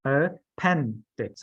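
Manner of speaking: "Appendix" drawn out, pen stressed; slow stressed model. Shown as a demonstration. Teacher slowly modelling a word for a student. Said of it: "put the emphasis".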